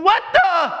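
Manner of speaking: a hint of indignant disbelief; high, cut-off exclamation; lightly acted exasperated prisoner; John. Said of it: "This is John at the prison gates going".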